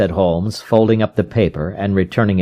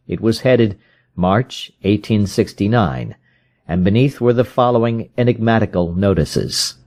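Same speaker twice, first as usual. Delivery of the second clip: ordinary talk, nothing marked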